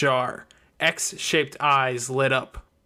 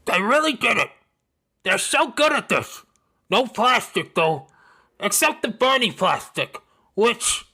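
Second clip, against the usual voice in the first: much higher, louder, gushing with food in her mouth; childlike Snowdrop thrilled, talking through cake; excited mouthful; 8 semitones higher, 3 dB louder than usual. Acted. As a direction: gush with delight while chewing. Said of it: "spoke around a mouthful of Bonky Donk".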